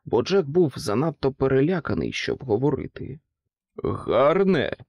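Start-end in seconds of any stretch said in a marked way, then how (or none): 3.8-4.8 displeased. A narrator's reading. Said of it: "перепитав невдоволено Влада".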